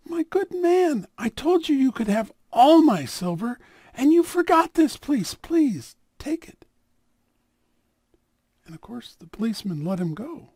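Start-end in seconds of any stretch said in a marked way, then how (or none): 0.0-6.6 earnest offer. Acted. Rabbi urges the thief to take the plate, high and pleading. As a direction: press the gift on him, beseeching.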